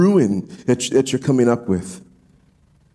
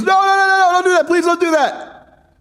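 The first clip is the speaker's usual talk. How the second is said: very high, louder frantic begging, slowing at the end; desperate pleading; 3 dB louder than usual. Retold or imitated. Amos pleading with God not to do it, high and loud. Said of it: "And Amos says".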